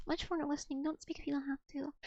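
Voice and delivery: soft unvoiced whisper. Real, not acted. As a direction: breathe the words with no voice. Whispered study advice.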